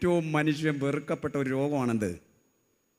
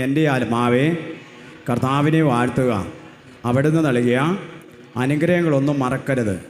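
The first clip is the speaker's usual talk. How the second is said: even louder recitation; 8 dB louder than usual. Shown as a demonstration. Preacher urging the crowd to say it louder, full voice. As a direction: push it out louder than the last time. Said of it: "ഉറക്കെ കൂടി, ഉറ".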